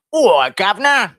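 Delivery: high, loud, drawn-out Cockney call; Cockney orc voice, slow and high-pitched; Cockney accent. Acted. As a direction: holler it as a hammed-up Cockney. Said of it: "Say, "Governor".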